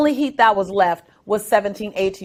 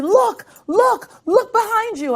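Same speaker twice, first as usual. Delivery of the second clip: high excited yell, much higher; coach screaming; 10 semitones higher, slower than usual. Retold or imitated. Coach screaming at the swimmer to look behind, high yell. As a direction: shout it across the pool. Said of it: "my coach is screaming".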